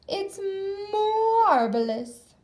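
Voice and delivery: slow, drawn-out delighted witch voice; witch savoring a word. A narrator's reading. Witch Clarabelle admires the oar as a spoon, slow and delighted. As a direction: stretch it out like a pleased witch.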